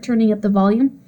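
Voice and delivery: usual speaking voice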